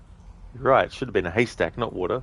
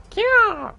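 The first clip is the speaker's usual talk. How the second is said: piercing high bird cry, much higher and louder; eagle screech; 5 dB louder than usual. Pretend. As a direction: shriek out a bird call. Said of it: "You're supplementing eagle noises".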